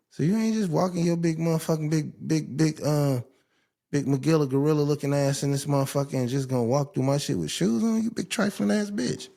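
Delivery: outraged rant. Real, not acted. Telling off a guest who walks in with shoes on, outraged and high. Ranting at a shoe-wearing guest.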